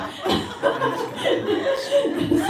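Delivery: high burst of laughter; breathy laugh running on for seconds. Real, not acted. Storyteller amused at the parents' plan, laughing.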